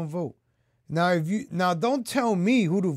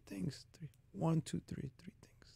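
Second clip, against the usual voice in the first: whisper; 15 dB quieter than usual. Real, not acted. Counting off the three things.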